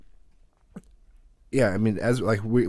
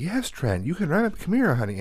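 lightly raised, sing-song coaxing; sweet big sister voice; 9 semitones higher than usual. Retold or imitated. Big sister coaxing little Trent onto the bike, sweet sing-song voice. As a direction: coax the little brother kindly, voice lifted. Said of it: "she actually is like".

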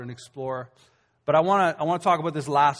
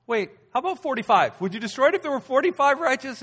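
much higher, louder anxious bargaining; pleading Abraham; 10 semitones higher, 5 dB louder than usual. Retold or imitated. Abraham bargaining with God for the city, anxious. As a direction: haggle nervously with God. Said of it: "And then Abraham goes".

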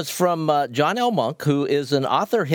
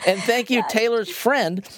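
chirpy thanks; 7 semitones higher than usual. Real, not acted. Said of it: "thanking the friend playfully".

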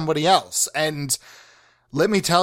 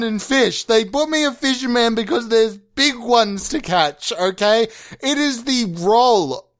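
defiant raised voice; 7 semitones higher than usual. Retold or imitated. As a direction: declaim it like a fisherman's creed. Alan's defiant reply to partner.

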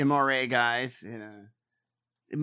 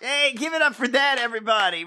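louder, higher game-show hype; hammed-up emcee cheer; 8 semitones higher, 7 dB louder than usual. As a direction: cheer it like a cheesy host.

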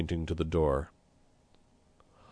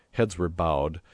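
ordinary delivery, nothing marked

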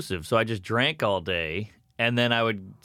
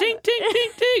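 very high, ringing little tinks; high-pitched vocal chimes; imitated glass clinking. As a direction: mimic cutlery tapping a glass for attention. Imitates glass tapped for attention.

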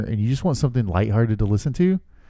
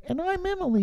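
high mock Emily voice; 7 dB quieter than usual. Voicing wife in intro.

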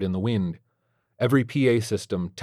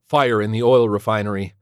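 ordinary delivery

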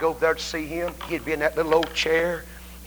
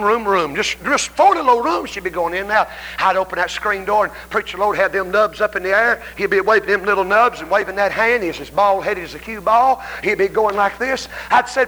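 usual speaking voice

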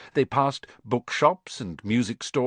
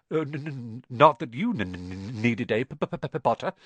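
nervous stammer. Acted. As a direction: trip over the P in fright.

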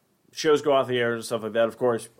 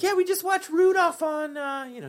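high put-on parent voice; imitating parents. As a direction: mimic the parents brightly. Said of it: "They were like".

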